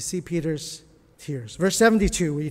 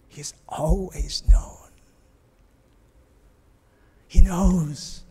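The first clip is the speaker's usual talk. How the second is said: slow whispered repeat; 7 dB louder, slower than usual. Shown as a demonstration. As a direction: repeat it as a hushed secret. Second take whispered.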